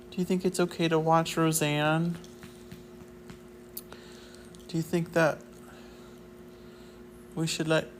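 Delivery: talking to the dog. Pretend. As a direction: coo at the dog like a talk show host. Keeps questioning the dog.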